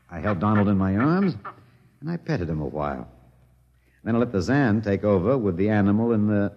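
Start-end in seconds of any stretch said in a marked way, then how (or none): none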